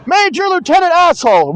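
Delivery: high, loud parody of a stiff officer; hammed-up movie soldier; mock officer voice. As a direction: announce the rank like a pompous soldier. Acting out a Spaceballs scene.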